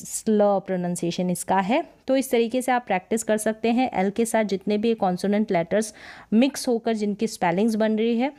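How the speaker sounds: ordinary talk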